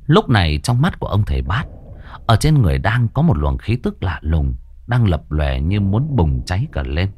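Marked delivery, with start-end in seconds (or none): none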